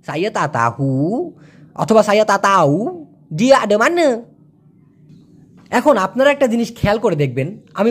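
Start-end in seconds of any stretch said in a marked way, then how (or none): 0.0-1.3 slow "সায়া তা তাহু", rising on a long hu; wavy pronunciation. Shown as a demonstration. Teacher showing an alternative, wavy pronunciation. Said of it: "ঢেউ খেলায়া বলে". 2.0-4.2 "সায়া তা তাহু ডিয়া আডামানা" with dropped h and schwa endings; wavy colloquial pronunciation. Shown as a demonstration. Teacher showing the other spoken way, wavy pronunciation. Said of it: "ঢেউ খেলায়া বলে".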